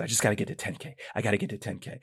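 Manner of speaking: very soft, low muttering to himself; hushed self-talk. Retold or imitated. Telling himself to reach ten K, hushed and low. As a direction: repeat it privately under the breath.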